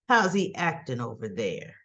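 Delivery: low-pitched mock man's voice; low, pointed retold question; imitated jealous man. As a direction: drop the pitch to quiz her suspiciously.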